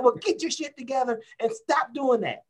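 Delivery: mock tirade of an old football coach; imitating coach yelling. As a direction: chew out a player on the field. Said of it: "He was like".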